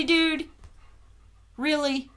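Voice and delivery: ordinary delivery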